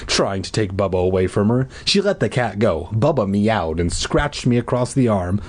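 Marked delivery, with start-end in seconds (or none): none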